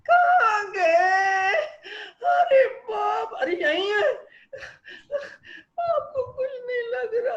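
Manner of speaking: high, loud, slow wailing shout; screeching wife. Acted. Wife screeching for husband at dawn, shrill alarm-like wail. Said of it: "पत्नी alarm की तरह बड़ी जोर से चिल्लाई".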